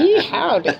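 loud, high Southern US twang; caricature southern accent. Acted. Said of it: "like, a southern accent".

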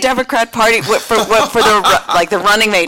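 high-pitched incredulous double take; incredulous repeat. Real, not acted. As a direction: sound thrown by the question. Baffled repeat of the question.

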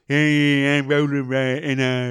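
put-on a light Essex accent, puppet rat; lightly voiced Essex puppet swagger. Host imitating Roland Rat, light Essex accent. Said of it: "Essex kind of dreary voice".

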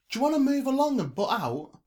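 high, loud, irritated challenge; annoyed and loud; confrontational. A narrator's reading. Girl confronts a staring stranger.

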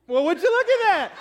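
high, loud sassy line with a laugh; hands-on-hips exclamation. Retold or imitated. Husband's panicked words morphing into a sassy exclamation. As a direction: let the panic morph into mock amazement. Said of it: "morphed into".

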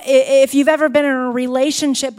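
usual speaking voice

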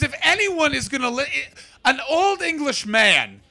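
high, slow, hammed-up old Englishman; old English man voice. Comedian hamming up an old English man, slow and high. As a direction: play a pompous old English gent.

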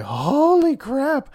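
high, slow, drawn-out gasp of shock; stunned. Retold or imitated. Reader reacting to the comic twist, stunned drawn-out exclamation. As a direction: stretch it out, jaw dropped. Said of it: "I was like".

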